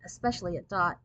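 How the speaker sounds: ordinary delivery, nothing marked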